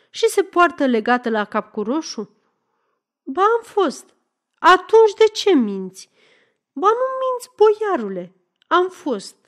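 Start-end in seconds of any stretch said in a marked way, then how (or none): 3.2-4.1 high, insistent contradiction; indignant denial. Acted. Woman contradicting her accuser, high insistent denial. 4.6-6.1 raised, louder challenge; angry accusation. Acted. Man questioning woman about the jacket, angry accusation. Acted interrogation over the jacket. 6.7-9.4 very high, insistent protest; protesting woman. Acted. Woman protesting to the boyar, high indignant denial.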